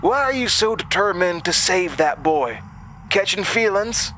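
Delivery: high, sneering voice; mocking taunt. A narrator's reading. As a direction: needle her with a sneer. Summers taunting Jasmine.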